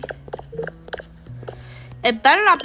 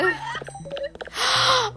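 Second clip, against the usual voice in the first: sharp gasp; 10 semitones higher, 6 dB quieter than usual. Real, not acted. Sudden fright.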